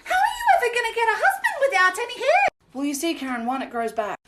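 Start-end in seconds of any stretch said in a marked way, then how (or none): 0.0-2.5 high mocking lady; shrill mock. Boomer lady mocking a bald woman, high. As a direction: pitch it way up, condescending. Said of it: "my absolute favorite".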